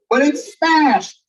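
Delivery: drawn out, slow and heavy; slow dramatic. A narrator's reading. Reading to kids, slow dramatic emphasis on the crash. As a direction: let the crash land slowly. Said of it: "dramatic story reading".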